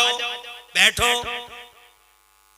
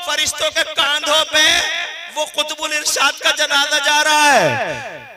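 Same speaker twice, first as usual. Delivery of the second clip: फरिश्तों के कांधों पे called out higher and louder; raised emotional telling; 4 semitones higher, 6 dB louder than usual. Retold or imitated. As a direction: lift the voice as if seeing a vision. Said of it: "वो रोते हुए कहते हैं".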